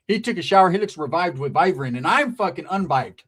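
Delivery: ordinary delivery, nothing marked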